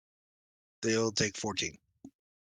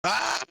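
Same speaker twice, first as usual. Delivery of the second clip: brief high yell; sudden high-pitched cry of attack; short battle yell; 10 semitones higher, 5 dB louder than usual. Acted. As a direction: let out a quick, loud "Ah!". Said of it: "and yell".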